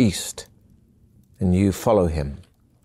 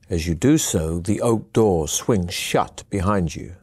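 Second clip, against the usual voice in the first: usual speaking voice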